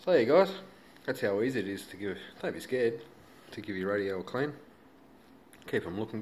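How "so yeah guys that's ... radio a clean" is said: normal talking voice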